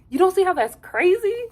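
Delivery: high, loud incredulous squeal through a laugh; mocking Ace. Recapping Ace confronting Nick, squealing disbelief. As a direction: squeal it like you can't believe him. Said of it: "He really talks like how I talk".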